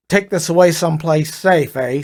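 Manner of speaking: a light Brooklyn lilt ending on a Canadian eh; lightly voiced Brooklyn guy with a touch of Canada; Brooklyn-Canadian accent. A narrator's reading. Fonzie hands over the paper in a light Brooklyn-Canadian accent. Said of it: "his accent was somewhere south of Brooklyn with a touch of Canada".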